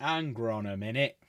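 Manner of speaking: stuffy nose, needs to blow it; blocked nose. Real, not acted. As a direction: speak through a blocked nose. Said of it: "I need to blow my nose".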